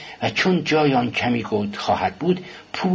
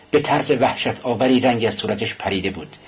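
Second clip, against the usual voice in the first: ordinary delivery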